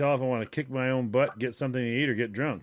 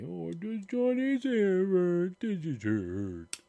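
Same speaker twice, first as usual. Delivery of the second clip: slow, teasing, sing-song mockery; mocking baby talk; 5 semitones higher, slower than usual. Imitating classmates mocking Johnny, slow and teasing. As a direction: tease him like a child, slowly. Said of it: "everybody made fun of me".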